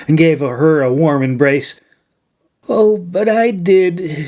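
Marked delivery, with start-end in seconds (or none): 2.7-4.3 higher, slow miserable crone's groan; wretched old woman. A narrator's reading. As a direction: moan it out in guilt. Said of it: "she groaned wretchedly".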